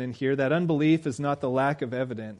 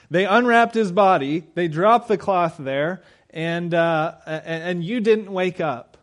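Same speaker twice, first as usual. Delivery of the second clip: higher, louder, slow disbelief; incredulous challenge; 5 semitones higher, 6 dB louder, slower than usual. Acted. Preacher mocking the guards' story, loud and incredulous. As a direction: scoff at the story as if it's absurd.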